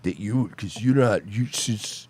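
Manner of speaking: low, mumbled mock forgetfulness; soft, halting, trailing off; fumbling for words. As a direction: imitate a bad storyteller losing his thread. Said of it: "he's just like, and then I was like".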